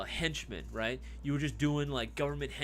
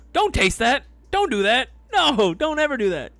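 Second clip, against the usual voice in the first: high, loud frantic plea; loud pleading; 11 semitones higher, 12 dB louder than usual. Real, not acted. Streamer frantically begging viewers not to taste battery juice, loud. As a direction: beg them to stop, loud and high.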